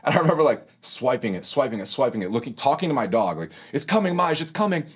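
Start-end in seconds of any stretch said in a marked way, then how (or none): 3.7-4.8 higher, hopeful coaxing to the dog; eager to the dog. Retold or imitated. Narrator to his dog, waiting on his paycheck, higher and eager. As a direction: tell the dog the money is on its way.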